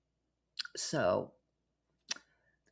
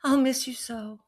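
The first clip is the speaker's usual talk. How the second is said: higher, hammed-up sweet goodbye; mock farewell; 10 semitones higher than usual. Saying goodbye to the scammer, higher mock tenderness. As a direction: bid him a sugary fake farewell. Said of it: "I'm like".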